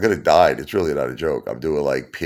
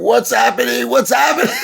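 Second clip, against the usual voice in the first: high, loud panicked scream; 8 dB louder than usual. Retold or imitated. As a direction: scream it in terror on the stretcher. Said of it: "like, screaming".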